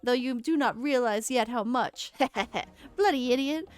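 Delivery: taunt, then call the Garleans in; gloating traitor. Acted. Laurentius taunting the adventurer and summoning Garleans.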